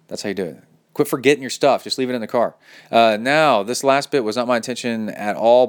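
usual speaking voice

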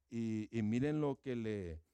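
ordinary delivery

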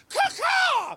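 very high squawking bird caw; shrill crow-like caw cry; werewolf sound. Retold or imitated. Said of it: "You know that werewolf sound?".

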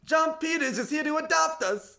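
put-on high-pitched kid voice; excited orphan child. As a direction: pipe up like a hopeful orphan. Playing an orphan greeting the visitor.

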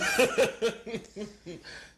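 loud high laugh; high delighted laugh. Real, not acted. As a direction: burst out laughing. Laughing at the bit.

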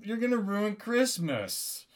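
high, whiny mock complaint; mocking imitation of a disappointed listener; objecting listener. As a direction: whine like an accusing audience member. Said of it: "You're saying".

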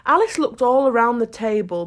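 ordinary delivery

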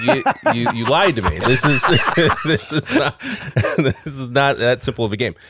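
mock exasperated wife, giggling; laughing through a teasing complaint; laughing, imitating wife. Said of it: "she's like".

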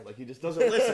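hammed-up annoyed doctor; mock frustrated doctor. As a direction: vent to the nurse. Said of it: "and he's like".

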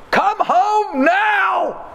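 high, drawn-out imitated shout; acted urgent yell down the phone; frantic call. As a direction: shriek it slowly, every word stretched. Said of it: "I get a frantic call".

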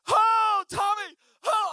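high, loud acted scream. Boy grabbed by dog calls his friend, high panicked scream. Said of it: "And I'm screaming".

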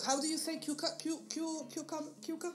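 high, halting fake accent, stumbling on cucumber; playfully mimicking a fake Spanish accent; mock Spanish-accented English. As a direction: pretend not to know the word, faltering high. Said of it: "ella finge que no conoce la palabra pepino".

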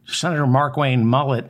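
usual speaking voice

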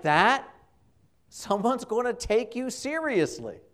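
Peter pleading. Retold or imitated. Peter voiced warning Jesus.

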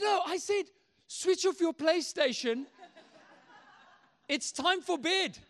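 high-pitched imitation of a protesting parent; exasperated dad. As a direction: spell it out like a dad at bedtime. Exasperated dad argues with boy.

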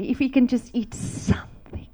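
breathless unvoiced plea; whispered prayer. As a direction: whisper the wish softly. Husband recalls birthday prayer, whispered.

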